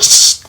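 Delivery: loud, sharp shush; imitated shushing. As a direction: shush like a manager silencing staff. Manager hushing her, retold.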